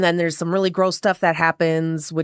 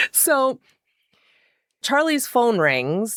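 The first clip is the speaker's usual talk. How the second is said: ordinary delivery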